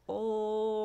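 "Oh" as a steady held L hum; long L sound. Shown as a demonstration. Teacher demonstrating letter L, its sound held long. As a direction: draw out the L sound written "Oh". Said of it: "how long we can make it".

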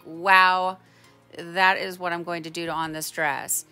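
normal talking voice

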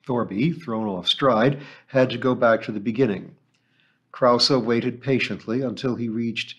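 usual speaking voice

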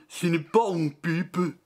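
a lightly put-on French accent. Painter showing off to the viewers in a French accent. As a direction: say it like a proud Frenchman. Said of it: "That is a very good French accent".

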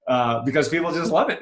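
normal talking voice

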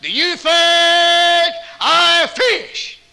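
high, very slow, stretched question; drawn-out question. Retold or imitated. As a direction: stretch it out to tease.

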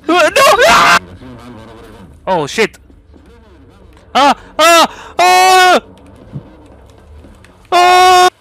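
loud frantic yelling; being chased. Real, not acted. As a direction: yell while fleeing a monster.

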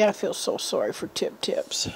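soft, breathless whisper for the poor dog; whispered pity. Real, not acted. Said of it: "He's making me sad now".

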